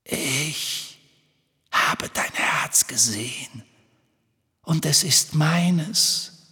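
slow, whispery evil spirit; sinister whisper rising into a possessive hiss. A narrator's reading. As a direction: hiss it out slowly, savoring each word. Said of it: "Dann zischte eine Stimme".

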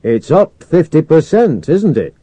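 loud, high, projected query; radio exchange. A narrator's reading. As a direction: put it to the commander over comms. Crewman answers commander over radio.

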